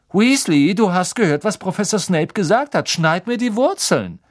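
high, loud, sneering order; gloating bossy schoolboy. A narrator's reading. Malfoy orders Ron to cut his roots, gloating and high. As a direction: lord it over him in a high voice.

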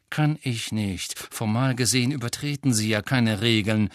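ordinary delivery, nothing marked

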